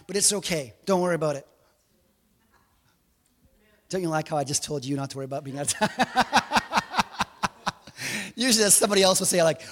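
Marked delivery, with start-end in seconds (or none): 6.0-7.7 amused burst of laughter; laughing. Real, not acted. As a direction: laugh at your own joke in a quick staccato run. Laughs at own joke.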